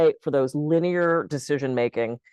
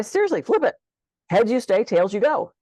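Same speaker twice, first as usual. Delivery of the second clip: urging insistence; 6 semitones higher than usual. Retold or imitated. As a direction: insist emphatically, pitch up. Coach urging client to flip coin.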